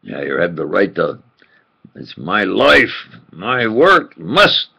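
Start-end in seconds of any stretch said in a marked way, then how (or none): none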